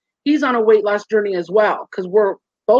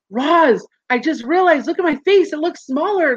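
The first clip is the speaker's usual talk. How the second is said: higher, eager retelling of showing a friend; light retold excitement over her face; excited reveal; 6 semitones higher than usual. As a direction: she calls Roz on FaceTime, excited and higher.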